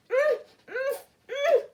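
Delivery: high muffled hums through a gag; gagged mumble. Acted. Gagged little sister trying to speak, muffled high hums. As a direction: hum urgently with a sock in her mouth. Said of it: "sock out of her mouth".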